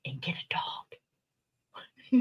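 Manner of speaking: slow conspiratorial whisper. Acted. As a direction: slip the advice in a slow whisper. Advice to the childless, hushed.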